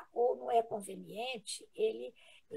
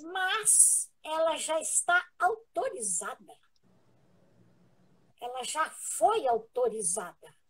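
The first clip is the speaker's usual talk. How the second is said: firm, louder, slow first line, retelling Chico's reply; voice of authority; 4 semitones higher, 7 dB louder than usual. Retold or imitated. Chico answering the medium, loud and authoritative. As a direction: declare it with full authority. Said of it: "ele falou com toda a autoridade, com voz alta".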